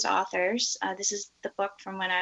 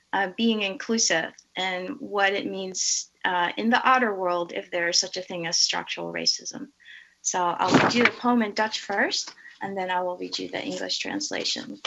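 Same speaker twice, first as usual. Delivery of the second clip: ordinary talk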